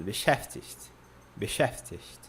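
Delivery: "Beschäftigt" run together as one word, low and soft. Shown as a demonstration. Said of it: "Aí, mais rápido".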